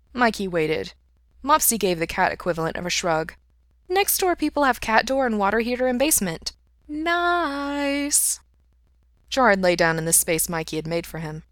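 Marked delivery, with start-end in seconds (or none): 3.9-8.4 high cat voice, relishing the last word; talking cat voice. A narrator's reading. Cat Mopsy describing next door, high cat voice. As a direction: pitch it up high, stretching the final word.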